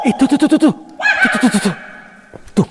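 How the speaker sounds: high, loud, rapid-fire pointing out; startled alarm. Real, not acted. Explorer suddenly spots a figure, startled alarm. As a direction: jolt into repeated excited cries.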